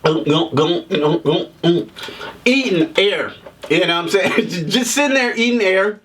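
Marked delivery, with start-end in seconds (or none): 0.0-1.9 low, rhythmic mock swallows; imitated gulping. Reviewer mimicking an extra drinking from empty glass, gulping. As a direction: gulp noisily on air, low and even. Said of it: "he's gulping before he even get".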